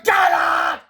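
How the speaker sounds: high, loud furious scream. Acted. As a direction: scream them out. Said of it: "Mister Crump screamed".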